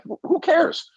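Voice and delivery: squeaky disbelieving who cares; exasperated. Real, not acted. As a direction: scoff at the whole idea. Exasperated rant about media respect.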